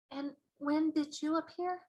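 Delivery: put-on a puzzled small girl; Sophie's voice. Sophie puzzled by the giant, softly.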